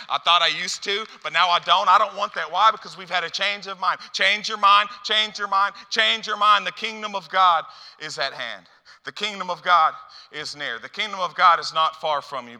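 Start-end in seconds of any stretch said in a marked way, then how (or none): 0.1-2.5 rising, loud preacher's cry; preaching at full force. Acted. Preacher driving home a changed heart, loud. 4.1-6.7 high, loud chant of the refrain; preaching refrain. Acted. Preacher urging the church to change, loud chant. Repeated exhortation. 6.7-12.6 loud, ringing declaration; preaching proclamation. Acted. Preacher proclaiming the kingdom near, ringing.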